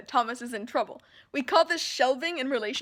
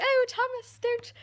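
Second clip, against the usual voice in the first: imitating Teresa; 11 semitones higher than usual. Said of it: "she's like".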